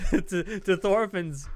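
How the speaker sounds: laughing through the name; giggling at his own slip-up. Real, not acted.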